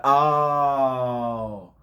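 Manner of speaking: long, loud, high "oh"; hammed-up knowing reaction; acted realization. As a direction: draw it out as it all makes sense. Acts out sudden understanding of friend.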